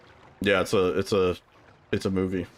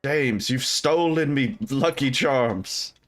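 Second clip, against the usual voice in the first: leprechaun; 5 semitones higher, slower than usual. Retold or imitated. Mocking Irish movie character.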